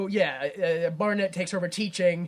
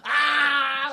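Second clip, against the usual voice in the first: high, drawn-out comic cry; mock tantrum wail; 8 semitones higher, 5 dB louder than usual. Imitates the henchman's hat-throwing tantrum.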